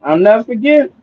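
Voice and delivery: ordinary talk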